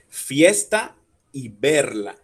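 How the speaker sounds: "Fiesta y verla", lento y fuerte en "es" y "ver"; sílabas fuertes marcadas. Shown as a demonstration. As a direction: alarga y recalca la E de "Fiesta" y "verla". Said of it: "La fuerza está en es y en ver". ("Fiesta y verla" pausado, golpeando la E tónica)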